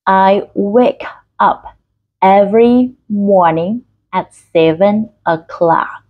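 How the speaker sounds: very slow teaching read; slow, word by word. Shown as a demonstration. Teacher models the mouth exercise for learners, slow and word by word. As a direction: read slowly, pausing between words. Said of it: "các bạn cố gắng đọc chậm từng từ".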